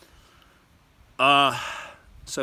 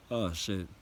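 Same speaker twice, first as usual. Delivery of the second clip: usual speaking voice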